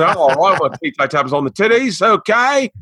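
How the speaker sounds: hammed-up British accent, jumping high at the end; rich British accent. Comic acting the man in the film, rich British accent. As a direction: lay it on as a cheeky Brit. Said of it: "doing a very rich British accent".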